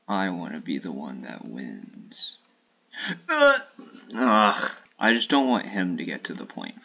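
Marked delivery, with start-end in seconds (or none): 3.3-3.6 high loud ugh of disgust; shrill frustrated ugh; frustrated shriek ugh. Real, not acted. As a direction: screech in annoyance. Frustrated noise.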